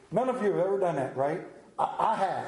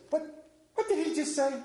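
higher-pitched mock puzzlement; baffled listeners; 9 semitones higher, faster than usual. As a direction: voice the congregation's bewildered reaction. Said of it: "look at all these faces".

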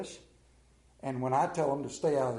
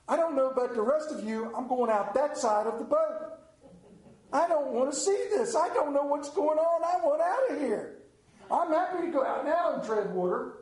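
frightened disciple; 8 semitones higher than usual. Acted. Preacher plays frightened disciple.

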